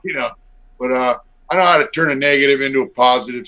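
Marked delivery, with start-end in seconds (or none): none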